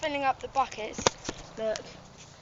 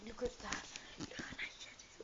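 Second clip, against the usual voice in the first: whispering; 3 semitones lower, 17 dB quieter than usual. Real, not acted. Quietly admiring the bike.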